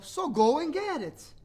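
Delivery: yawning brother. Acted. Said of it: "Little Critter yawned".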